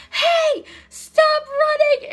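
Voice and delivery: high, loud, drawn-out holler; imitated shout. Someone yelling after two campers running downhill, shouting. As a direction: call after runaways in a put-on high shout.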